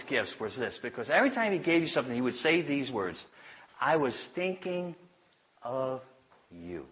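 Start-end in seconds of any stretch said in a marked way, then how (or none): 3.8-6.9 slow and tender, fading to a near hush; tender quote. Retold or imitated. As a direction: hold each word as if it were a gift. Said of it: "Aren't those words beautiful?".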